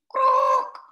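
loud, high frog croak. Shown as a demonstration. Teacher shows a frog's noise, loud and high. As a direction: let the croak jump out high. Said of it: "The noise that a frog makes is croaking".

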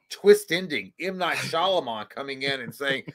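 normal talking voice

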